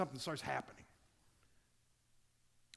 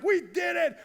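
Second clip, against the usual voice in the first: excited triumph; 9 semitones higher, 6 dB louder than usual. Retold or imitated. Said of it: "Dopamine's like".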